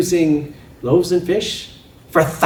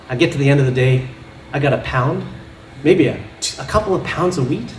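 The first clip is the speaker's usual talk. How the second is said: ordinary talk, nothing marked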